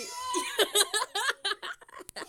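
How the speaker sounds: high burst of laughter. Real, not acted. Laughing at falling friend.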